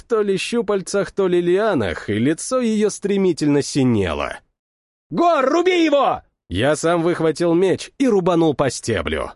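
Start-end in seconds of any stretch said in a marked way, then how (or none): 5.1-6.2 shouting. A narrator's reading. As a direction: bark the command in panic. Urgent order in a fight.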